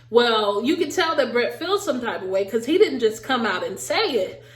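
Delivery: ordinary talk, nothing marked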